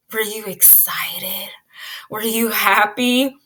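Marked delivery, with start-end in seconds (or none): none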